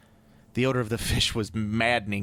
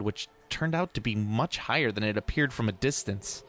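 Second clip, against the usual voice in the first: ordinary delivery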